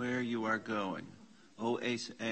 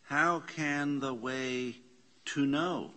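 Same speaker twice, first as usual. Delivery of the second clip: slow question; 2 semitones higher, 5 dB louder, slower than usual. Shown as a demonstration. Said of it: "Question mark".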